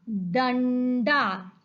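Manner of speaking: डंडा very slowly, with a long n; syllable by syllable. Shown as a demonstration. Teacher spelling the picture's name for children, डंडा drawn out. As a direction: stretch डंडा, holding the nasal and final आ. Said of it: "हम इसे लिखेंगे कैसे".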